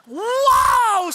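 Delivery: high, loud astonished exclamation; woman on a plane shouting in amazement; loud wow. Retold or imitated. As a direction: shout wow so everyone wakes up. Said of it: "sa sobrang lakas, nagising yung lahat".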